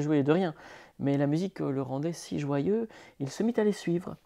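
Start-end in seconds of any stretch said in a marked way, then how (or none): none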